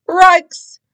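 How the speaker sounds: a high cry of alarm in a dog voice; Scooby's frightened cry. Acted. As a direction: yelp it like a scared cartoon dog. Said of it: "cried Scooby".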